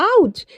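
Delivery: sharp high yelp of pain; pinched in the tummy, a sudden high ouch. Acted. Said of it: "wing बहुत जोर से चिल्लाता".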